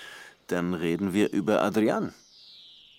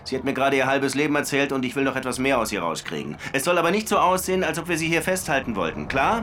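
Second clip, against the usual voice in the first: urgent phone call; 6 semitones higher than usual. Acted. Das ist meine einzige Münze.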